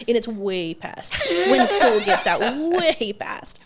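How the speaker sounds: laughing through the words. Real, not acted. Realizing she's late for school, laughing. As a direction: let the laugh break the line.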